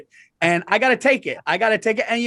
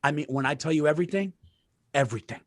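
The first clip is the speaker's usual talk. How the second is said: much softer, fast, secretive aside; hushed confiding; 6 semitones lower, 7 dB quieter, faster than usual. Real, not acted. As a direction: keep it low so the wife won't hear. Hiding plan from listening wife.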